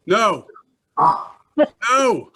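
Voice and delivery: firm correction. Real, not acted. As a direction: correct the student sharply. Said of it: "correcting a student firmly".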